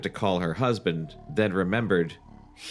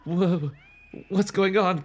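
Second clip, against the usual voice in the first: stammering; 9 semitones higher than usual. Acted. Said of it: "Chris stammered".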